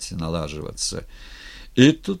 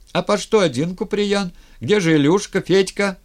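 high, loud, urgent calling; raised, eager crowd voice; clamouring questions; 10 semitones higher, 6 dB louder than usual. A narrator's reading. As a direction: fire questions from an excited crowd. Crowd noisily questions the returnee.

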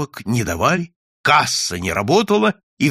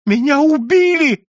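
high, loud cry of outrage; shrieked complaint; anguished shout; 14 semitones higher, 7 dB louder than usual. A narrator's reading. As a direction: wail it out at the top of the pitch. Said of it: "закричал невидимый".

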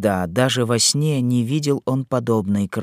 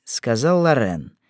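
usual speaking voice

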